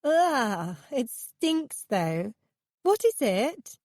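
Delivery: a hint of a giddy young woman turned off by a stink; Miranda's voice. A narrator's reading. Miranda reacting to a foul smell, lightly voiced.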